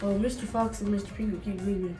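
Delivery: normal talking voice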